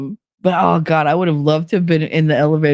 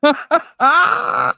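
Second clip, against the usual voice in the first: laughing; 12 semitones higher, much slower than usual. Real, not acted.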